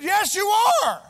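high, loud, slow verdict; doctor's loud, deliberate yes; blunt doctor. Retold or imitated. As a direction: deliver the hard truth loudly and slowly. Doctor bluntly confirms he's dying.

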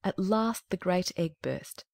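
ordinary delivery, nothing marked

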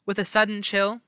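plain reading voice

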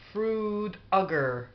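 ordinary delivery

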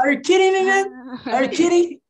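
high-pitched, hammed-up disbelief; mock incredulity. Shown as a demonstration. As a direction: demand it like you can't believe your friend. Acting out an incredulous catchphrase. (incredulidade exagerada, voz aguda)

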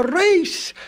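high-pitched, insistent call; excited cry. A narrator's reading. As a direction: cry it out to start a race. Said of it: "cried the hare".

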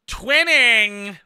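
high, loud, stretched exclamation; gleeful call. Pretend. Streamer delighted to match a friend, loud gleeful call. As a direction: belt it out like a catchphrase.

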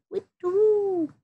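high slow owl hoot; a high slow owl call. Pretend. Owl goodnight sound.

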